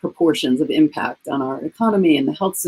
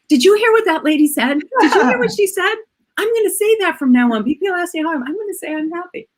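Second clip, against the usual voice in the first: much higher, thrilled retelling of the clerk; excited clerk; 11 semitones higher than usual. Retold or imitated. Clerk telling the man in line about the happy lady, high excited gush. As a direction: gush it to the next customer, wide-eyed. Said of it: "I hear her say to the guy next to me".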